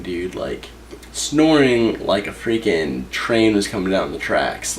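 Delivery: normal talking voice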